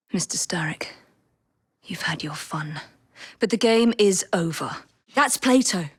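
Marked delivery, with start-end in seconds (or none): 0.1-2.8 soft unvoiced threat; menacing whisper. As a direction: breathe the threat into his ear. Stealthy threat to villain.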